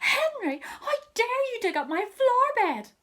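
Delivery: high, loud outraged telling-off; furious scolding. Acted. Mum scolds Henry for the dug-up flower bed, furious and loud. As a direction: blast him for digging up the flowers.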